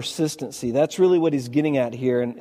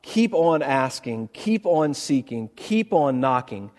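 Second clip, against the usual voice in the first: slow, emphatic; slower than usual. Shown as a demonstration. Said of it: "it can be read".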